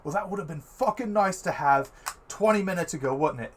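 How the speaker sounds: loud, high, fast sarcastic outburst; angry exclamation. Acted. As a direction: exclaim it loudly, pissed off at being too late. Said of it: "he just exclaims; he's just kinda pissed".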